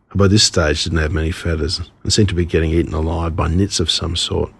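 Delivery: usual speaking voice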